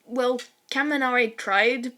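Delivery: ordinary delivery, nothing marked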